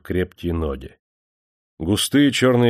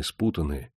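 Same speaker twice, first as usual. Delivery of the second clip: ordinary delivery, nothing marked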